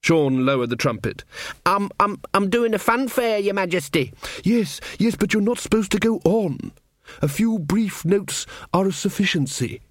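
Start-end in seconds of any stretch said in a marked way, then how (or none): 1.7-4.1 put-on higher, hesitant young lad; flustered servant. Servant Sean defending his fanfare to the king, flustered and higher. 4.4-9.8 sniffy. Acted. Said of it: "Verence sniffed".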